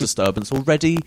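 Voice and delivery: ordinary talk, nothing marked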